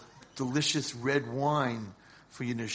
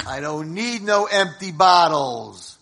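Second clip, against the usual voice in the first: put-on drunken scorn; 2 semitones higher, 8 dB louder, slower than usual. Drunk man refuses the souvenir.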